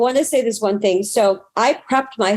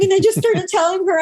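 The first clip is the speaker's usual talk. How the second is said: ordinary talk, nothing marked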